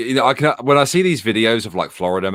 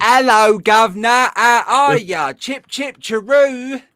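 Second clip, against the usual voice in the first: high, loud, cartoon Cockney greeting; mock British accent; 7 dB louder, much slower than usual. Brit mocking Americans who imitate his accent, high-pitched fake Cockney. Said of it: "Every American trying to imitate my accent ever".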